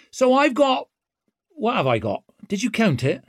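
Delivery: swaggering rogue taunting; brash young woman. A narrator's reading. Ricker voice.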